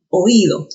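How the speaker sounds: oído with o and í kept apart; stress on the í. Shown as a demonstration. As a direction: lean hard on the í of oído. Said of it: "La O con la I se han separado".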